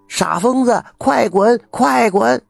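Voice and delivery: high, loud jeering yells; crowd shouting. A narrator's reading. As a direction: shout him off like an angry crowd. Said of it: "人们都在喊". (众人冲疯老头大喊快滚)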